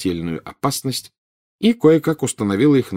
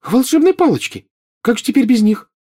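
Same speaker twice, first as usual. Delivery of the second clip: put-on high, raised voice of a worried girl; alarmed outcry; 5 dB louder than usual. A narrator's reading. Girl hears the wandmaker is gone, alarmed. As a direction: cry it out in dismay, pitch way up.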